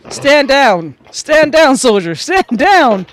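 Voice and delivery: loud military order; shouted command. Acted. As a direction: bark it like a drill sergeant.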